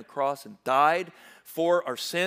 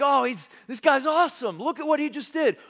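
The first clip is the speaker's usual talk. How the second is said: excited crowd; 8 semitones higher, 4 dB louder than usual. Retold or imitated. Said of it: "They're like".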